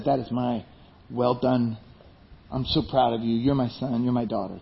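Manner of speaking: soft, low, tender fatherly praise; hushed, heartfelt affirmation. Retold or imitated. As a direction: speak softly and low, like a proud father. God tenderly affirms his children.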